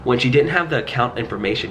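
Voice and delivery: ordinary talk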